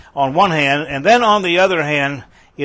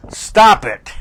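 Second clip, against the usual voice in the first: sharp, raised, louder order on "stop it"; emphatic command; 6 semitones higher, 5 dB louder than usual. Acted. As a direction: punch out "stop it" high and loud. Acting out the verse's command.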